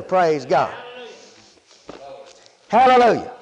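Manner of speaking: normal talking voice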